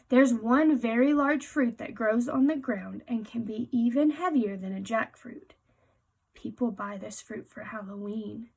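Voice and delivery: normal talking voice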